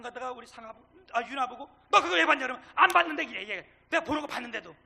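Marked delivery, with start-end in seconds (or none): 1.9-2.5 loud, raised-pitch accusing question; mock telling-off. Acts out questioning a child. 2.7-3.2 loud, high defensive reply; reflexive denial. Retold or imitated. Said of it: "이게 왜 반사적인 거예요".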